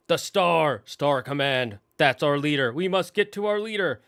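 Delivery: excited toy soldiers. Retold or imitated. Buzz toys spotting their leader's picture, excited proclamation. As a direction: proclaim it like an urgent space ranger.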